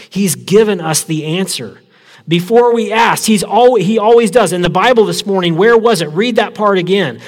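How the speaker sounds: high, loud, bubbling over with joy; she's just found the answer, burst with it; excited. A narrator's reading. Betsy telling her sister God answered, high and thrilled. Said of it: "she said excitedly".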